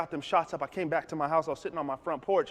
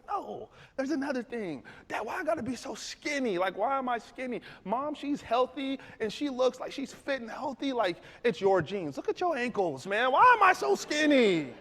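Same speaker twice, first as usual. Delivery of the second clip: put-on whiny teen; 10 semitones higher than usual. Son whining to dad.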